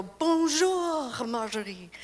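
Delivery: put-on lilting Italian-accented bonjour; Italian accent, imitating neighbor; Italian accent on French. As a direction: sing it with an Italian lilt. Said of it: "because of this Italian accent".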